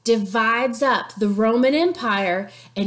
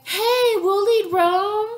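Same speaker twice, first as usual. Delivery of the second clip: mock triumph; 7 semitones higher, slower than usual. Antony and Octavian voiced.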